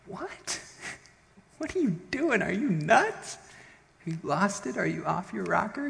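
high-pitched, frantic disbelief; incredulous servant. Acted. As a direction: question his sanity in mock panic. Panicked servant scolds calm prophet.

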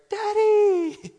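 put-on high, loud squeal of a little kid; excited child. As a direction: shriek it like a toddler greeting dad at the door. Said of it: "and they're like".

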